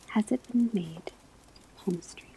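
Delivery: ordinary talk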